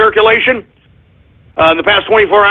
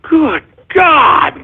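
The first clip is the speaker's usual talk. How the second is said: much higher, cracking shout of frustration; real exasperated cry-shout over missed profits; pissed-off outburst; 11 semitones higher, slower than usual. Real, not acted. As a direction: yell it like money just slipped through your fingers. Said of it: "I'm pissed off about that".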